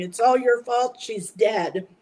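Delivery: mock shout of accusation; yelling doctor. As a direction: bark the blame like the angry doctor. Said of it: "the doctor's yelling".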